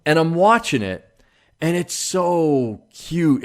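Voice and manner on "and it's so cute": slow, gushing so cute. Real, not acted. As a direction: melt over how cute it is. Gushing over the cute kitten.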